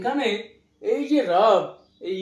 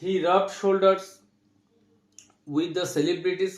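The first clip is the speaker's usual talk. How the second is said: slow dictation; much slower than usual. Shown as a demonstration. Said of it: "আমরা বলতে পারি".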